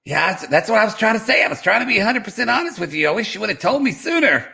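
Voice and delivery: Darcy answering her sister; playing Darcy. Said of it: "playing the role of Darcy".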